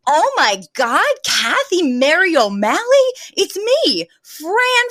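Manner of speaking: thrilled, pitch climbing high on her name; excited recognition. Retold or imitated. Fran retold, spotting an old schoolmate, rising excited. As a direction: gush at an old classmate. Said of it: "her eyes widen and she's like".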